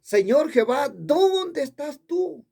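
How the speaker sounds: high cry with a long-drawn "dónde"; re-enacted last shout. Preacher re-enacts his last call to God, a high drawn-out cry. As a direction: stretch it out high, calling up to heaven. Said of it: "la última vez que grité".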